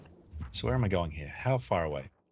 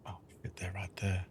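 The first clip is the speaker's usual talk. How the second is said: hushed alarmed whisper; 3 semitones lower, 14 dB quieter than usual. Real, not acted. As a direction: whisper it so they won't hear. Zombies close, hiding.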